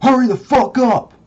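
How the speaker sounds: screamed high and loud, furious; angry shout. Retold or imitated. Bully kicking the door open, screaming at him to hurry.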